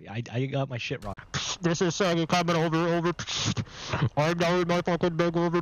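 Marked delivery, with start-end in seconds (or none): 1.6-3.1 pilot on the radio; clipped radio chatter, over; radio call voice. Pretend. 4.2-5.6 strained, breath held in; holding breath. Real, not acted. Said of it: "holding my breath in".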